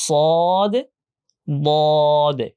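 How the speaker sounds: very drawn-out "সদ" and "বদে" with rounded o; thick, heavy pronunciation. Shown as a demonstration. Teacher sums up both letters, thick pronunciation. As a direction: hold "সদ", then "বদে", long and heavy. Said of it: "আর মোটা করতে পারলে".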